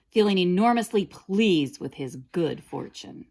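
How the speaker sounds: usual speaking voice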